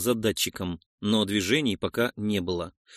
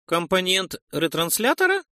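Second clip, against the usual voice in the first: higher, doubtful question rising at the end; distrustful questioning; 7 semitones higher than usual. A narrator's reading. Noise asks about the metal plate found, distrustful questioning. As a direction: ask it warily, pitched up. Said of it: "недоверчиво нахмурился и вопросительно спросил".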